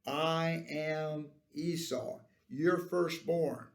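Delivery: "I am" stretched out slowly; slow, deliberate claim weighted on "I am"; special emphasis. Acted. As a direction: declare it slowly, every word given weight. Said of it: "This was of special em-, emphasis".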